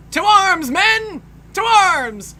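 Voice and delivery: high, loud battle call; rally the troops like a cartoon general; hammed-up call to arms; rallying cry. Voicing cartoon character rallying troops.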